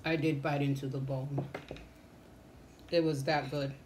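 chewing between words; talking while eating; mouth full of food. Real, not acted. Seen 0: Fork goes to mouth at 4.1; jaw and lips chew around it.

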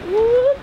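high, loud rising "whoop"; playful whoop. Real, not acted. Playful exclamation while naming the destination.

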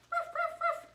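high yappy woofs from the puppet; puppy barking. Acted. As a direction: bark like a small excited dog. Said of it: "Doggy is here today".

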